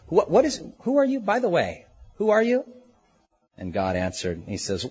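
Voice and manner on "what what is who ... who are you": high, halting mock bewilderment; flustered questioning. Jacob asking whom he wrestled all night, flustered questioning. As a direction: stumble into the question, pitch jumping up.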